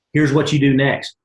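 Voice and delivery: ordinary talk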